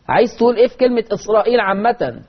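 ordinary talk, nothing marked

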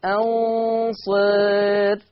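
أنصر with the alif slowly tilted toward e, very drawn out; taqlil on the alif. Shown as a demonstration. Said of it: "شوف ميلت الألف هكذا إزاي".

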